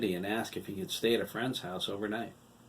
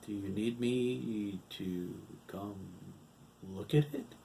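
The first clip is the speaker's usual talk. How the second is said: put-on concerned old man; 3 dB quieter, slower than usual. A narrator's reading. Hiram concerned, quiet.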